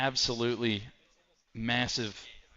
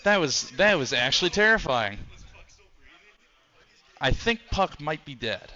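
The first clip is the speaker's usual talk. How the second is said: live fight commentary, raised and eager; excited play-by-play; 4 semitones higher, 3 dB louder than usual. Acted. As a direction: call the action ringside.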